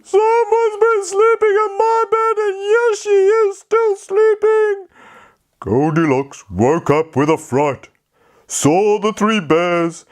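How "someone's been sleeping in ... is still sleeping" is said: put-on very high, whiny baby bear voice; a tearful little bear, pitched way up; crying Baby Bear. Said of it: "started to cry again".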